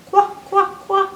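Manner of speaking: high little "wock" quacks; baby duck quack. Acted. Reader voicing the sound of Q, baby duck quack. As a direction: quack like a duckling who can't quite say it. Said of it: "kinda like a baby duck".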